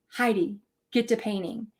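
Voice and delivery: a hint of a stern husband, low and blunt. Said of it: "I don't think I was that mean".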